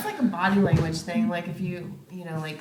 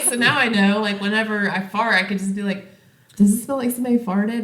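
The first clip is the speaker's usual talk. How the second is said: excited retelling; 4 semitones higher, 7 dB louder than usual. Retold or imitated. Retold plan, excited quote.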